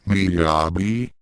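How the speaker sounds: Miyabi? lightly worried, rising at the end; a touch of concern, asking; mild worried question. A narrator's reading. Akan checks on fainted Miyabi, mildly worried. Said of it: "asked Akan worriedly".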